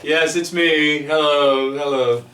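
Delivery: loud, high, drawn-out self-important hello; hammed-up star arrival; mock celebrity greeting. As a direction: pretend to greet adoring fans. Mocking himself as a celebrity greeting.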